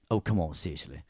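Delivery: ordinary talk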